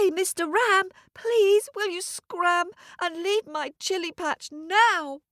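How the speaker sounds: put-on high, pleading little boy, mildly tearful; almost in tears. Little boy pleads with the ram, almost in tears. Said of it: "almost in tears".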